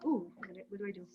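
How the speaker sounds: ordinary delivery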